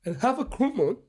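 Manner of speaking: mock-emotional delivery with a long dramatic pause; emotional dramatic reading. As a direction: read it like a big emotional film moment. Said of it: "He got emotional".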